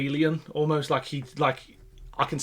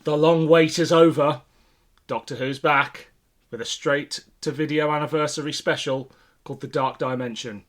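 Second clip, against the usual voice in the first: reading a headline; 2 semitones higher, 7 dB louder than usual. Retold or imitated. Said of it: "it was announced on the front cover".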